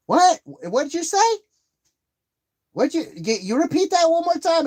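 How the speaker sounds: stunned, demanding she say it again; incredulous outburst. Retold or imitated. Disbelief at cousin's girlfriend.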